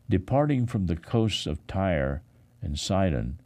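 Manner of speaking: ordinary talk, nothing marked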